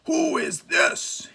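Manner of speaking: high, very slow gruff demand; Angry storming in, shouting rudely at the stranger. A narrator's reading. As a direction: bark it at the stranger. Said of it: "he shouted rudely".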